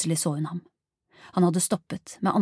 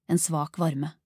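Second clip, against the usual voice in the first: plain reading voice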